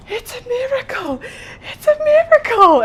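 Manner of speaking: high, loud mock accented gushing; shrieking it's a miracle; excited tech. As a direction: gush it like a thrilled tech. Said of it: "she's like".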